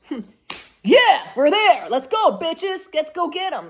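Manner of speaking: loud, high, slow hyped-up ancestors; voicing the ancestors. Reader voicing ancestors to the viewer, loud and eager. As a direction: cheer them on like ancestors rallying. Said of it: "Your ancestors are like".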